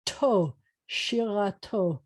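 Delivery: to alone, then shirato slowly joined up; sounded out. Shown as a demonstration. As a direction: build shirato slowly from its last syllable to. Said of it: "can you sound it out?".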